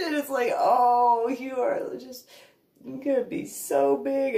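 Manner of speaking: high, tearful cooing to a dog; teary baby talk. Retold or imitated. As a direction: coo through laughing tears. Praising puppy, teary.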